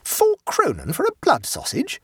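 high, incredulous outburst; high-pitched indignant question; shocked exclamation. A narrator's reading. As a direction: protest the price in disbelief. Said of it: "exclaimed Abbleway".

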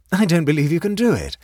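high, loud teasing taunt; provocative. A narrator's reading. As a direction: call out a playful challenge. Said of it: "laughed Mary provocatively from the conservatory".

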